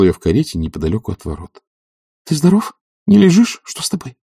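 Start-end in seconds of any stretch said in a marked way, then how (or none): 2.3-4.2 high female character's queries; anxious visitor, pitched up; rapid questioning. A narrator's reading. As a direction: fire off concerned questions in a high voice. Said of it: "бегло спросила она".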